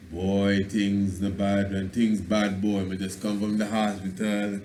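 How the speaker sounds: droop into a pitiful complaint; weak patois complaint. Shown as a demonstration. Lecturer has audience repeat a sick man's moan. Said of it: "repeat after me".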